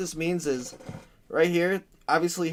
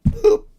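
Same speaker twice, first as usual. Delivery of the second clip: very high, loud boop; a sharp, high button beep; 9 dB louder than usual. Pretend. Streamer to chat, Putin pressing the Poland button, loud boop.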